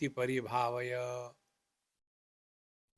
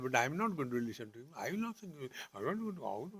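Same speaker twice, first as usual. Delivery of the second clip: defiant retort; 7 dB quieter, much faster than usual. Retold or imitated. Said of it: "If he says that".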